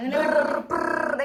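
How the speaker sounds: slow, high, playful "ബ്രർ" engine buzz; car noise. Pretend. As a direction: buzz like a toy car for baby. Car engine sound for baby.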